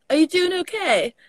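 high-pitched British-tinged polite question; UK accent. Retold or imitated. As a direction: ask it high, with a hint of British. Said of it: "I still sound a bit too U K".

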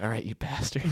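low, soft, grim self-address; mock-grave, hushed low voice; self-talk in mirror. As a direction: mutter it deep to your own reflection. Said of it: "looks at himself in the mirror".

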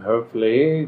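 ordinary talk, nothing marked

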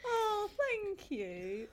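moved almost to crying by the praise; touched, near tears. Real, not acted. Friend says she's proud of her.

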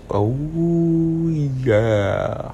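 breathy crooned oh yeah; smooth oh yeah. Shown as a demonstration. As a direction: ease it out like a lounge DJ. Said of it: "You know who else did that "oh, yeah" voice?".

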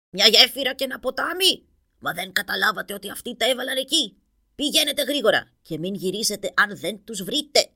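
loud furious roar at the warriors; roaring in rage. A narrator's reading. King Boni scolds returning warriors, roaring with rage. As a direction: roar the orders, beside himself. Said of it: "βρυχήθηκε ο Μπόνι".

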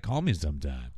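low, coy flirtation; mock flirty. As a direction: imitate a flirt, dropping the pitch. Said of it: "gets all flirty with him".

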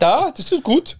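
high fake German accent. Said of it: "get a German accent".

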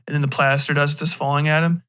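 ordinary delivery, nothing marked

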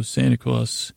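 ordinary talk, nothing marked

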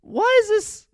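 high squeal. Retold or imitated. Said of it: "I'm like".

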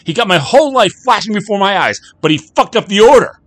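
raging high-pitched shout, rushed at first. Acted. Jack ranting at the bartender about his stolen life, raging shout. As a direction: scream it all out, nearly unhinged. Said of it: "shouted Jack".

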